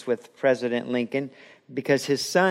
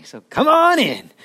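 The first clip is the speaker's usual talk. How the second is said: high, loud joyful welcome; welcoming call; 9 semitones higher, 7 dB louder than usual. Acted. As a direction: throw the door open with a shout of welcome. Jesus welcomes believer at judgment.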